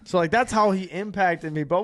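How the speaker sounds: normal talking voice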